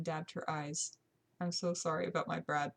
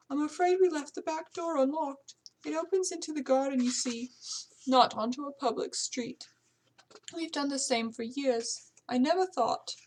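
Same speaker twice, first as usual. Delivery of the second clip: high, louder housekeeper voice, apologetic; higher-pitched British servant confessing a lapse; worried British housekeeper; 7 semitones higher, 4 dB louder than usual. Acted. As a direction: explain anxiously as the housekeeper.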